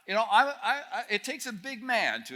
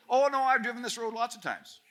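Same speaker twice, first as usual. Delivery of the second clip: higher-pitched mock protest; breezy dismissal; 7 semitones higher, 4 dB louder than usual. Driver to worried passengers on the wrong road, breezily dismissive. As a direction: wave the doubt away in a raised voice.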